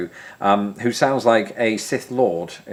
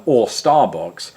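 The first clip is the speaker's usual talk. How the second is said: ordinary talk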